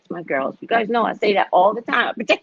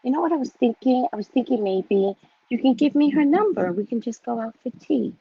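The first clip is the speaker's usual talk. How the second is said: higher, innocent-sounding suggestion; fake sweet; 6 semitones higher than usual. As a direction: feign innocence in a raised, sugary voice. Fake-sweet invitation hiding a threat.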